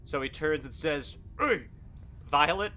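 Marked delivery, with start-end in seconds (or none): 1.4-2.7 high, louder orc hello; orc greeting. Acted. Orc chief greeting the party, high and loud. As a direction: greet them brightly as the orc.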